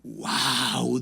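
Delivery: amazed wow. Retold or imitated. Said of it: "dije yo".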